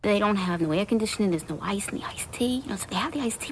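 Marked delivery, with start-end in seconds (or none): 1.3-3.5 whispering. Real, not acted. Complaint said in a whisper.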